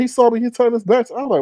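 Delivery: ordinary delivery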